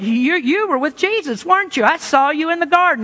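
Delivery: high-pitched, pointed accusation; acted accusation. Accuser confronting Peter, acted.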